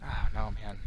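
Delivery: ordinary delivery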